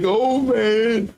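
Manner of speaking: high, giddy mock glee; a little giddy. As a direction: act out a card player who can't hide delight. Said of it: "start getting a little giddy".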